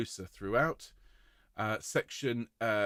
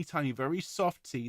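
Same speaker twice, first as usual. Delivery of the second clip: ordinary delivery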